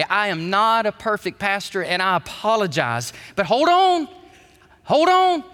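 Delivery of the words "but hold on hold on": high, loud call to stop and listen; a sharp pulpit call to wait; emphatic hold on. Real, not acted. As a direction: rein the room in with a sudden raised cry. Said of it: "preacher stops the flow".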